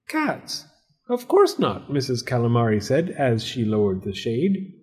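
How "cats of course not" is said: very high disbelieving denial; hammed-up innocent surprise; feigned innocence. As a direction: play innocent, pitched way up. Tenant feigns innocence about cats.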